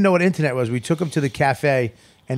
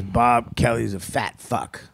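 speech therapy sentence; 2 semitones lower, much slower than usual. Retold or imitated. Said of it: "I'm at speech therapy".

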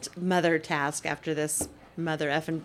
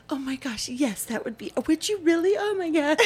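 gushing daughter; 7 semitones higher than usual. Retold or imitated. Daughter's grateful gush voiced.

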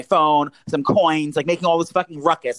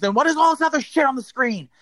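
high-pitched indignant question; retold screaming; 10 semitones higher than usual. Customer questioning the charges, mild retold screaming. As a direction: imitate screaming without the volume. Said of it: "I'm going off screaming".

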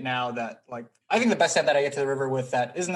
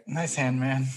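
begrudging nice hand through clenched teeth. Acted. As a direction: force out a sour congratulation. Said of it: "That was through the most clenched teeth that I've ever heard".

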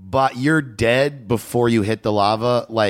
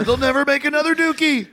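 put-on whiny emo teen; 13 semitones higher, faster than usual. Joins mocking emo teen.

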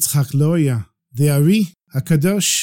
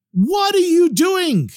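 high, slow startled shout; yelling; slower than usual. A narrator's reading. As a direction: bellow it across the synagogue. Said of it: "he hears the rabbi yelling at him".

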